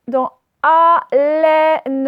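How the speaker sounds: slow, loud teacher demonstration of "haleine"; stretched demo word. Shown as a demonstration. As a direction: sound out "haleine" slowly for children. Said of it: "le est, il est ici".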